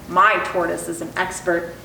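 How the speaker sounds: ordinary delivery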